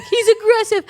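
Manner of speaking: high, pressing insistence; insistent alarm. Retold or imitated. Insisting to the training facility her dog is dangerous, high and urgent. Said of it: "And I was like".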